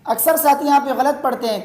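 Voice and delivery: usual speaking voice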